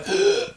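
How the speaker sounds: sharp high gasp for air; desperate gasp. Retold or imitated. Surfer surfacing after nearly drowning, a desperate gasp.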